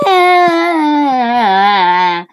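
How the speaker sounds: loud, drawn-out falling scream; long wail. Acted. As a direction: melodramatic cry of despair. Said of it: "I was like".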